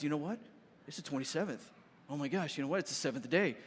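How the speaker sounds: low, quiet voice of dawning dread; hushed realization. Acted. A disciple in the rowing boat realizing the date, hushed and low. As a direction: drop low and soft, as if just realizing.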